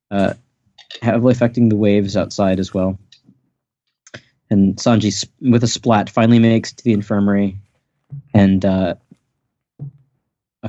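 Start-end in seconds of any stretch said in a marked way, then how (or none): none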